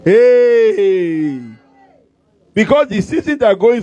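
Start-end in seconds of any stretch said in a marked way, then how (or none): 0.0-1.4 preacher's hey. Real, not acted. Preacher calls to congregation.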